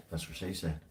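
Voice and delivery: low, soft deadpan aside; muttered punchline. Acted. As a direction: drop it under your breath, dry. Repeated deadpan joke.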